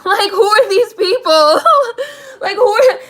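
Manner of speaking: high, loud cry through laughter; incredulous, laughing outburst; laughing disbelief. Retold or imitated. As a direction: crack up while exclaiming in disbelief. Retelling shared disbelief at the movie's cast.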